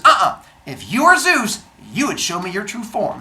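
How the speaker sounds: loud, high argumentative retort; acted sassy confrontation; indignant challenge. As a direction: challenge them to prove it, loudly. Acted quarrel with Zeus.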